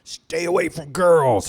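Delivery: high, loud, slow mock lecture from the sponsor; stern sponsor warning. Sponsor lays down the law to the newcomer, loud mock warning. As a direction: bellow the rule like a preachy sponsor. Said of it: "he'd be like".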